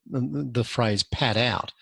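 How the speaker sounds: "pad out" slowed right down and set apart; careful wording. Real, not acted. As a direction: draw out "pad out" slowly, as if holding the phrase at arm's length. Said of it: "I'm not gonna use the word".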